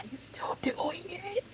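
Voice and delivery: hushed whisper. Retold or imitated. On the phone after mom leaves, whispered question. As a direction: whisper so mom won't hear.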